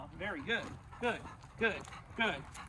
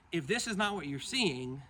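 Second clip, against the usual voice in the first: ordinary talk, nothing marked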